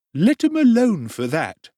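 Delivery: high ingratiating tradesman; oily undertaker. Acted. Undertaker voiced in story.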